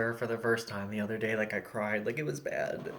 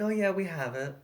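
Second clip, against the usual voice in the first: fake friendly phone voice; 6 semitones higher than usual. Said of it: "fake friendly customer service voice".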